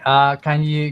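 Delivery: ordinary delivery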